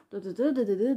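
loud rapid nonsense syllables for endless scolding; blah-blah imitation of a rant; mock nagging noises. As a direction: imitate someone going on and on. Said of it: "ang dami na sinasabi".

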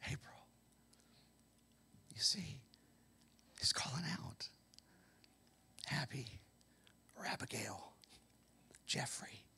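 hushed whispered name; whispered call. Pretend. God whispering a listener's name.

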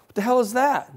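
high-pitched, acted bafflement; astonished question. Investigator sees phones all over the floor, high astonishment. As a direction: react to a bizarre sight. Stunned at room full of phones.

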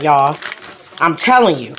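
usual speaking voice

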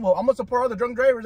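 ordinary talk, nothing marked

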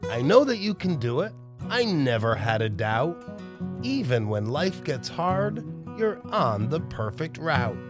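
ordinary delivery, nothing marked

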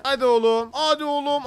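excited urging. Real, not acted. Player urging the fishing line on, excited. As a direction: pump up the catch.

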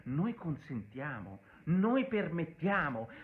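sneering impression of the prime minister, slowed down; mocking the PM. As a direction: drag out the PM's words with contempt. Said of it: "il Presidente del Consiglio che mi dice".

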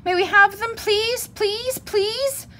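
put-on very high-pitched, insistent child pleading; shouted begging. A narrator's reading. Cubs begging parents for toys, pleading shrilly. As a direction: repeat the plea in a high squeal. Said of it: "they shouted".

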